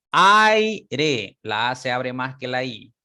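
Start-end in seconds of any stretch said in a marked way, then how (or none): 0.1-1.4 aire said louder and higher, stressing the open A; model word. Shown as a demonstration. Teacher showing the open vowel, emphatic. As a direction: hit the A in aire hard. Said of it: "Por ejemplo".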